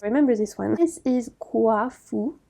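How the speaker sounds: ordinary talk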